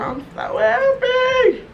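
high, drawn-out squeal of joy; happy squeal. Real, not acted. Thrilled vlogger squealing over lashes sent by her friend. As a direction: squeal it like a thrilled gift opener.

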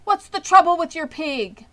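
startled announcer shout; alarmed loudspeaker. A narrator's reading. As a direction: call out in alarm across the fair. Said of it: "asked the loudspeaker".

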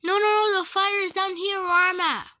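rising panic, high and loud; alarmed cry as the fire spreads; panicked. Real, not acted.

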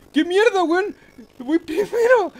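high, loud yelp of disbelief; shocked outburst. Real, not acted. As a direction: yell it out, stunned to be leading. Suddenly takes the lead.

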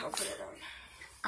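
slow whisper. Real, not acted. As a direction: breathe it without voice. Unvoiced reply off camera.

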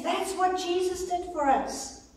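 normal talking voice

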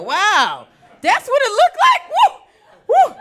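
high, loud shocked outburst with whoops; hammed-up amazement, voice shooting up; astonished. Comedian reliving her first sober look at it, astonished.

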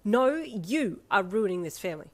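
loud, emphatic retort; angry, forceful rebuke; snapped. A narrator's reading. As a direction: snap back, swooping on the blame. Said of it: "she snapped".

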